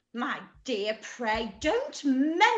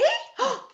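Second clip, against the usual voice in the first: delighted gasp; 10 semitones higher than usual. Acted. Mother hears of a guest.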